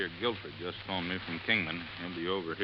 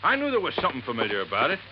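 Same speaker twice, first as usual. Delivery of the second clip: it clicks all at once; sudden realization; 6 semitones higher than usual. Acted. Buster realizing to Johnny where the boat came from, surprised.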